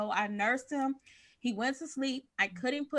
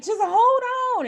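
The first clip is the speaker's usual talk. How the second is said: much higher and louder; pleading call; 8 dB louder than usual. Retold or imitated. Mother in the shower calling to screaming baby, urgent. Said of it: "I'm just like".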